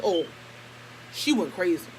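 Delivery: ordinary talk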